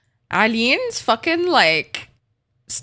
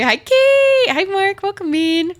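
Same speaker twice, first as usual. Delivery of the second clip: much higher excited squeal greeting; 10 semitones higher, 4 dB louder, slower than usual. Real, not acted. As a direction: squeal the hello way up high.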